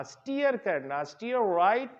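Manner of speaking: ordinary delivery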